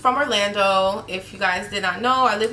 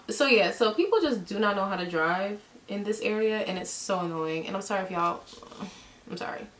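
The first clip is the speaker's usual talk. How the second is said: ordinary talk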